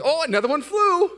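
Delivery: an amused, unbothered helper; cheerful patient friend. Retold or imitated. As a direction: play him easygoing as another nail flies. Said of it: "He goes".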